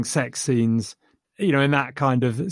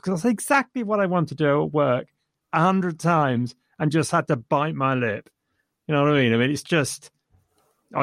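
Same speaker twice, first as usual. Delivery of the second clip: usual speaking voice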